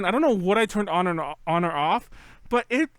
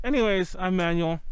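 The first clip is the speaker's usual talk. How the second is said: ordinary delivery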